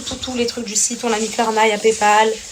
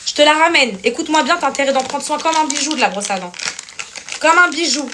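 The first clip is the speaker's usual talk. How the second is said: mock scolding; 6 semitones higher than usual. Mock threat to husband.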